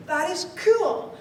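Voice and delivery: high, loud delighted "wow"; thrilled wonder. Retold or imitated. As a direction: gush with amazed joy. Delighted thought after the ride.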